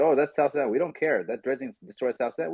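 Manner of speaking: ordinary talk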